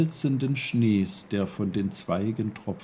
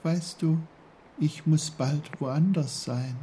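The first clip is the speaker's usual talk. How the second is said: high, small flower voice; high-pitched little character voice; little snowdrop, explaining; 7 semitones higher than usual. A narrator's reading. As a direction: explain gently as a tiny snowdrop.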